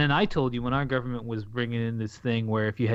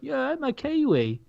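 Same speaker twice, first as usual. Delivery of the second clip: slow, higher-pitched imitation of a Kiwi; imitated proud Kiwi; 10 semitones higher, slower than usual. Co-host quoting New Zealand coworkers, in a lightly imitated Kiwi voice. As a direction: lightly mimic a New Zealander owning the nickname. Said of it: "and they'd be like".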